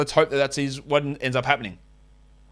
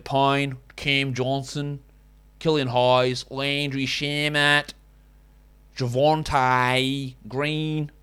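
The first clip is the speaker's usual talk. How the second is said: slow, drawn-out fake Australian; bad Australian accent; much slower than usual. Shown as a demonstration. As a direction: labour through a terrible Australian accent. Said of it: "I'm sick of doing that".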